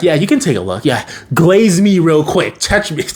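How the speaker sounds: put-on flaunt it like the coolest kid around; show-off. Evil Ben showing off.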